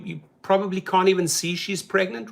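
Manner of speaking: usual speaking voice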